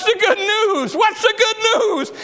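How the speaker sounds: high, urgent preacher's cry as the nurse; insistent demand. Retold or imitated. Grouchy nurse asking the little girl, high and insistent. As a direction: demand it high, pressing harder.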